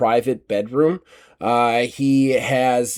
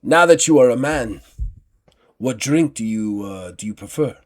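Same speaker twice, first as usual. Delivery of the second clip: uncle voice. Acted. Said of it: "He turns and he goes".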